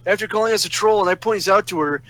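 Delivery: ordinary talk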